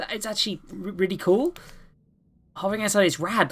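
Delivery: put-on high, eager kid voice building to a call; excited kid. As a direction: kid showing off something amazing. Cal shows off his hill.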